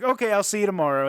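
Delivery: high, loud breezy farewell; loud cheery goodbye. Retold or imitated. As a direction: toss it off brightly on the way out. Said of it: "John Carradine's like".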